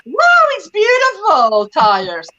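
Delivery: high, drawn-out mock wow; fake admiration. Daughter obliged to praise father's tires, fake admiration. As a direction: gush dutifully over something dull. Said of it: "But I'm like, I must go".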